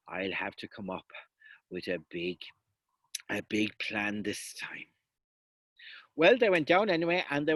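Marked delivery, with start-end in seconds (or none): 0.1-4.9 scheming under breath. Acted. Sean scheming to himself.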